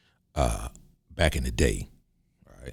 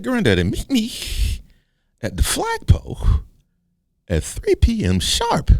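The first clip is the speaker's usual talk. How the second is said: indignant grandson. Acted. Young grandson challenges granddaddy to a fight, indignant boy voice. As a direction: challenge in a squeaky kid's voice.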